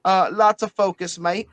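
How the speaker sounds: a light Australian twang, mate; a hint of an Australian bloke; Aussie accent. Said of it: "Can't do the accent for too long".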